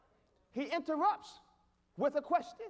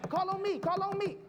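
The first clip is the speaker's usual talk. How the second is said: pleading like a pupil wanting to be picked; higher, insistent interruption; eager hand-raiser; 4 semitones higher, faster than usual. Acted. God raising his hand to interrupt, eager hand-raiser. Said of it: "he'll raise his hand".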